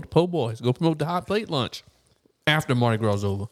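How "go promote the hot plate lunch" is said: ordinary delivery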